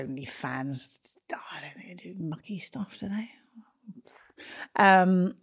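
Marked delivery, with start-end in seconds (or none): 0.0-3.3 whispering. Real, not acted. Embarrassed aside about OnlyFans.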